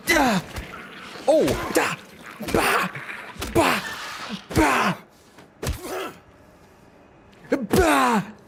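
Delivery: high sharp attack grunts; melee effort shouts. Pretend. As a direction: yell with each swing. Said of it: "making the sounds".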